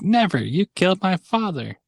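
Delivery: high-pitched mock-heroic outburst; Pinocchio. Pinocchio accusing the head vampire, high-pitched mock outrage. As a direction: cry it out high, hammed-up and defiant. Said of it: "Pinocchio is like".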